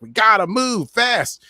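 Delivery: high, slow urgent command; urgent Spock. Retold or imitated. Spock urging his team to hurry, slow and high.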